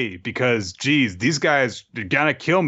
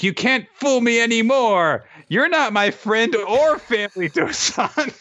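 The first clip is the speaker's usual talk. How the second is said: high mock scolding; 12 semitones higher than usual. Kusanagi rejecting Dosan.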